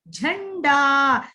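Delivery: झंडा drawn out slowly, every sound clear; teacher spelling out झंडा syllable by syllable; slow model word. Shown as a demonstration. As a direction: stretch झंडा out for the children.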